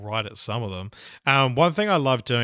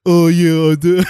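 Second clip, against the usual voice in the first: loud, higher-pitched mock voice; mocking each other; 6 semitones higher, 14 dB louder than usual. Co-host making fun of his friend, loud mocking voice. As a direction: take the piss in a loud put-on voice. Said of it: "our making fun of each other, like, being like".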